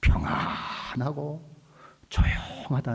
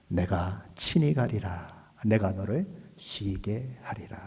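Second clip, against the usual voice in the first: slow, low solemn promise; reassuring; 3 semitones lower, 4 dB quieter, slower than usual. Retold or imitated. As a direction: voice God's assurance slowly and softly. Acting God's gentle reassuring answer. (낮고 느리게 안심시키는 말투)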